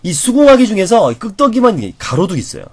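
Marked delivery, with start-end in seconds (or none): none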